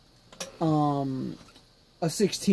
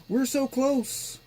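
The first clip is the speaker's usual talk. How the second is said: higher and slower, with "so" drawn out; excited; 7 semitones higher than usual. Real, not acted. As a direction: stretch "so" high and eager. Repeated as boot nears.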